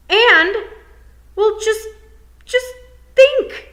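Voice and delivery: high, loud, outraged cry; exasperated shout. Acted. Tiffany shouting at the unbothered toad. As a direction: burst out in disbelief at the toad. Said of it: "I don't like being shouted at".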